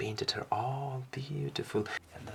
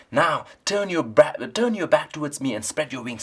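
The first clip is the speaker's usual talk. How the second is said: louder, higher bossy raven; raven instructing; 4 semitones higher, 8 dB louder than usual. Acted. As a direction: tell the owl what to do. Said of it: "said the raven".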